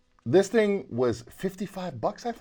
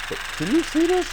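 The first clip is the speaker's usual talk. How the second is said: excited; 13 semitones higher, 4 dB louder than usual. Real, not acted. Excited at vibration.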